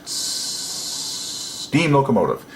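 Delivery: long hissing S starting "steam locomotive"; dragged-out S. Shown as a demonstration. Teacher showing the steam locomotive effect, "steam" with a dragged-out S. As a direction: hold the S of "steam" like escaping steam. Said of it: "you can drag out this S".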